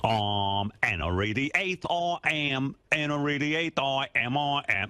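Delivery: very slow, drawn-out British king; British accent. Pretend. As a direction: stretch each word like a pompous royal. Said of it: "I say".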